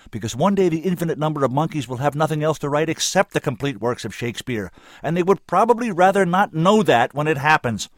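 loud, high, urgent pleading; impassioned outburst. A narrator's reading. Woman finishing her plea to the senator, impassioned. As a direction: build to a high, forceful climax.

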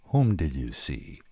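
very slow, low, patient rumble; massive patience. A narrator's reading. Bill interrogating his two friends, massively patient. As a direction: ask slowly and low, with heavy patience. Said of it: "he inquired with massive patience".

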